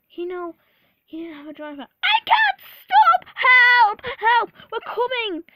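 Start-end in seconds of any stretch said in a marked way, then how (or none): 2.0-5.4 very high, full-volume panicked yelling; hammed-up frantic shouting; crying out. A narrator's reading. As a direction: scream for help in alarm. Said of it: "cried Edward".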